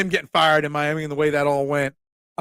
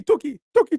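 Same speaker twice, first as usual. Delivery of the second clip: high far-off bird-like Tuki; silly high-pitched call; 9 semitones higher than usual. Acted. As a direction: chirp it out like a call. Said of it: "me adding Tuki".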